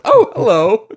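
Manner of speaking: put-on bright phone-style hello; mock startled greeting. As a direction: imitate her suddenly switching to a greeting. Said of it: "she looks and goes".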